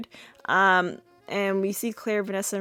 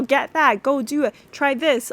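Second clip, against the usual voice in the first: mock frantic orders; 6 semitones higher, 6 dB louder than usual. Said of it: "they do the thing".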